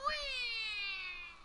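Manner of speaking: very high, squealing whee; mock joyful squeal; gleeful whee. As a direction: squeal like a kid on a slide. Imitating the jumper.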